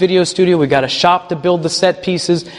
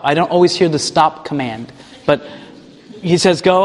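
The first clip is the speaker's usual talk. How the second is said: ordinary talk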